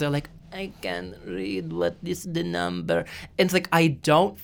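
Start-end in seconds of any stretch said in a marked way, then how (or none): 0.5-3.0 softly slurred, slow imitation of a drunk tourist; drunk customer. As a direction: mimic a confused drunk customer, drawn out. Mimicking drunk foreign customers.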